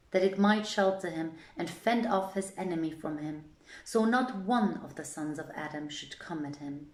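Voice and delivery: ordinary delivery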